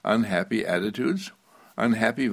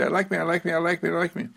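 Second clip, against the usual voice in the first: higher, hurried, mechanical repeats; rattled off; 7 semitones higher, much faster than usual. Shown as a demonstration. The wrong way to affirm yourself, rattled off high. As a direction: rattle it off high like a rote chant. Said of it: "I don't say".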